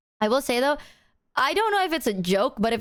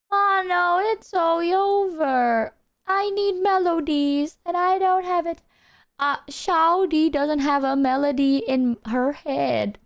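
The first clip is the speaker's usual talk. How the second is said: higher, slow wailing lament; mock melodramatic lament; 7 semitones higher, slower than usual. Lamenting to chat about having no melody, melodramatic. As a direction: whine dramatically.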